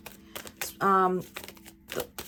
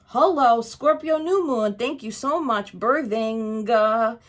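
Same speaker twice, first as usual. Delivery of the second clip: excited greeting; 4 semitones higher, 6 dB louder, slower than usual. Real, not acted. Excited greeting to the new moon.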